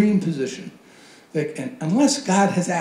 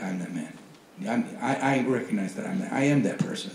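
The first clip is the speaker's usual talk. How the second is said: soft, subdued confession; quiet admission; 4 semitones lower, 5 dB quieter than usual. Acted. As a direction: own up softly, acting out David. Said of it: "and David says back".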